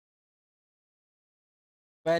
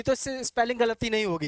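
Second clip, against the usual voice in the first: ordinary talk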